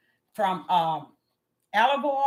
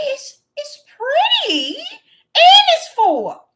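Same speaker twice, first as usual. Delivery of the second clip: much higher, louder, slow with laughing sobs; joyful outcry; 9 dB louder, much slower than usual. Retold or imitated. Preacher recalling her regrown hair, laughing and crying. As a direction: cry out in happy tears. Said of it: "I said".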